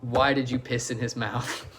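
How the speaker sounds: low, soft deadpan question; retold principal. Principal questioning a student, low mock-serious. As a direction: ask it quietly and seriously, understated. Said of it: "the exact word she says is".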